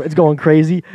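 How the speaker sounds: normal talking voice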